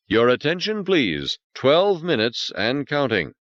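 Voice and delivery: high, measured computer voice; automated countdown voice; intercom announcement. Acted. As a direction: announce it like a station PA.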